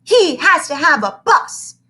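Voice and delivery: put-on high, loud tattling child; a bossy little girl telling on him; little girl protests. Girl protests to teacher, high and loud.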